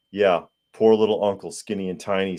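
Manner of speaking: ordinary delivery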